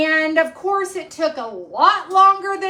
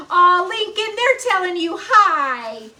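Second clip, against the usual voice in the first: higher, louder cooing to the pet; pet talk; 4 semitones higher, 4 dB louder than usual. Real, not acted. Owner telling her pet Lincoln the viewers say hi, cooing.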